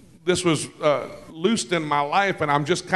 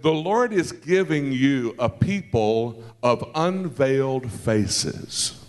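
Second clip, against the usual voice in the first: slow, lower, solemn prophetic voice; slow and weighty, lower, as if God is speaking through him; prophetic declaration; 4 semitones lower, slower than usual. Retold or imitated. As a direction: declare it like a word from the Lord. Prophesying over a pastor couple.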